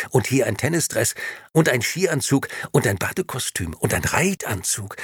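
building excitement. Acted. Tossing out clothes, building.

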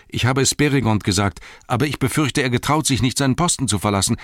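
high, fast, agitated blurting; gushing out the story. A narrator's reading. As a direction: spill the news in a panicky rush. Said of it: "So sprudelte Pippin die Geschichte hervor".

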